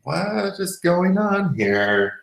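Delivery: high and slow, voicing the sore hip; 腰が困惑して話すように、高く、ゆっくり; mock confused voice. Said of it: "the whole hip area was like".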